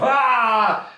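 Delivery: high, short celebratory shout; hearty cheer. Acted. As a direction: cheer as the glasses go up. Tankards raised in a cheer.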